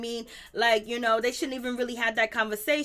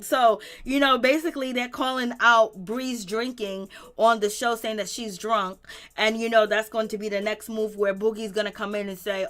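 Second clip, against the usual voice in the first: ordinary talk, nothing marked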